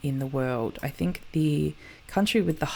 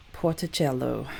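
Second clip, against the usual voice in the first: light Italian lilt, falling at the end; slightly Italian accent; 2 semitones lower than usual. Real, not acted. Host introducing the Bolivian town, slightly Italian accent. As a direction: give it a hint of Italian accent. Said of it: "with a slightly Italian accent".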